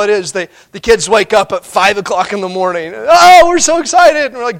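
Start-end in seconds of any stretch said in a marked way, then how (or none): 2.9-4.3 put-on high, loud, gushing kid voice; child wakes parents early on Christmas, all excitement; mock kid excitement. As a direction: burst in like a thrilled child at dawn. Kids waking at five.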